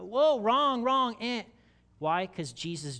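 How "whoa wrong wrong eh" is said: high, louder mock complaint; mock protest. A goat objecting to its side, high and startled.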